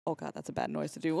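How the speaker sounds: usual speaking voice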